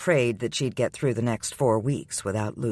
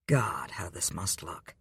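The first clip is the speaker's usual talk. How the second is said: hushed, slow inner thought; whispered thought; 5 semitones lower, 7 dB quieter than usual. A narrator's reading. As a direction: breathe it out under your breath.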